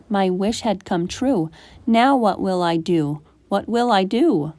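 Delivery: ordinary delivery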